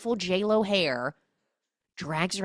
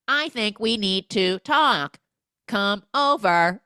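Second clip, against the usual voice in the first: slow, louder mechanical robot voice; 5 semitones higher, 9 dB louder, slower than usual. Retold or imitated. Recorder faking Kristen Chenoweth's voice, slow robotic read. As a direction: read it flat like a machine. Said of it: "robot Kristen Chenoweth voice".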